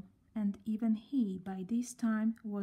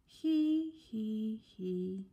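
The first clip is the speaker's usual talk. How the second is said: miserable laugh; much slower than usual. A narrator's reading. Sad girl miserably trying he he. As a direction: laugh that dies away. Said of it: "she said miserably".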